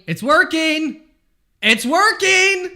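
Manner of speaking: high, loud, thrilled screaming; excited shriek. Real, not acted. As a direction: burst out in real joy as the price jumps. Trade turns up after praying.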